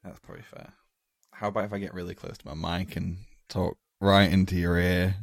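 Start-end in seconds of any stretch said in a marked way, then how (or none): 1.3-5.2 right in your ear. Real, not acted. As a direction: close to the mic, louder. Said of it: "I'm enjoying that".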